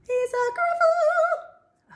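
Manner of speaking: high sung climax; high ending. Shown as a demonstration. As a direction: cry it out on seeing the Gruffalo. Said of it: "finally seeing the Gruffalo".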